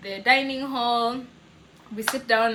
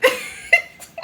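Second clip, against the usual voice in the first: high, breathy laugh half crying; teary laugh; 8 dB louder than usual. Real, not acted. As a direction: laugh through the tears. Laughing at the memory.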